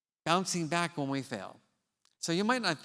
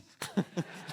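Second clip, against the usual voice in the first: breathy laugh. Real, not acted. Laughing at his own blunder.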